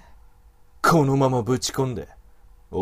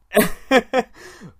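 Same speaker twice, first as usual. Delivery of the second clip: higher, louder mocking laugh; evil chuckle; 10 semitones higher, 6 dB louder than usual. Wolf man mocks the woman's lack of pride, evil chuckle. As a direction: sneer with a laugh.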